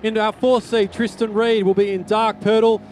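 normal talking voice

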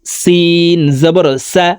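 সিন and সা said thin, drawn out; thin letter. Shown as a demonstration. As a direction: keep the s of সিন light. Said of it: "সিন পাতলা করে উচ্চারণ করতে হবে".

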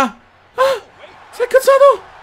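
very high mock voice teasing; mocking squeak. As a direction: squeak it to mock his anger. Mocking the angry opponent.

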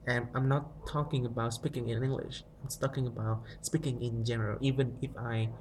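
ordinary talk

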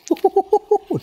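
very high, excited hoots; high hooting. Real, not acted. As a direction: whoop in quick high bursts. Hooting at a character's arrival.

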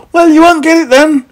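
high, loud defiant retort; shouting back. A narrator's reading. Meddle yelling back at his pursuer, shouting. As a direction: yell it back at full height. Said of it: "shouted back Mister Meddle".

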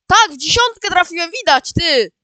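high-pitched, delighted exclamation; excited triumph. Real, not acted. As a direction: burst out celebrating a perfect shot. Celebrates hitting the bullseye.

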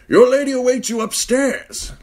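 put-on high, loud old servant voice; old man voice. A narrator's reading. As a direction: speak as an aged knight.

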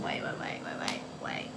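whispered wait, wait, wait to correct herself; hushed stammering correction, unvoiced. Real, not acted. Whispering in the cafe, correcting herself.

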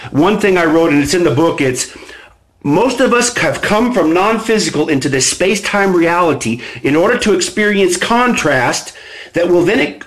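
ordinary delivery

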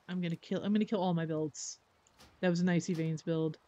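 normal talking voice

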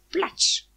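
loud, high one-word splash; splash sound. A narrator's reading. Hen hits the water, loud imitated splash. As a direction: exclaim the splash noise.

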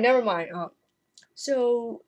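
usual speaking voice

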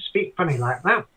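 lilting Northern Ireland accent. Shown as a demonstration. Language enthusiast showing how people speak there, Northern Ireland accent. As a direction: put on a Northern Irish lilt. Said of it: "speak with a Northern Ireland accent".

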